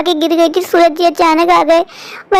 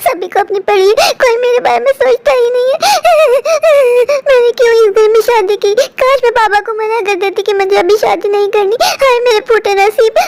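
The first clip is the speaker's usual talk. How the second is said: self-pitying lament; 7 semitones higher than usual. Acted. Bahu laments her fate.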